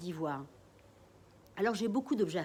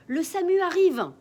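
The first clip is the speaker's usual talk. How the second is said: high, loud urgent call; urgent announcement; 10 semitones higher, 11 dB louder than usual. Acted. Mother announcing the ambulance is on its way, loud and urgent. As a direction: announce the ambulance coming in a rush.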